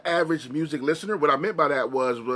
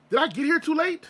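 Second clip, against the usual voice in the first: much higher, anguished question; dismayed question; 12 semitones higher than usual. Retold or imitated. To himself about dead metal heroes, high dismayed cry. Realizing heroes have died.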